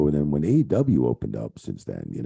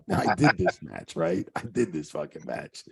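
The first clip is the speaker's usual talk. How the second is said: ordinary talk, nothing marked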